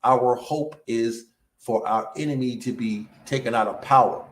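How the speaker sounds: ordinary talk, nothing marked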